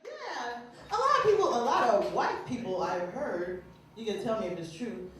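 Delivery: ordinary talk, nothing marked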